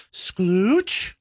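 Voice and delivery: "sklooch" stretched out with a squelchy oo; drawn-out sklooch. Real, not acted. Host describing a splash of Sunny D, drawn-out sklooch. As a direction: draw out the word "sklooch" slowly. Savoring a made-up word.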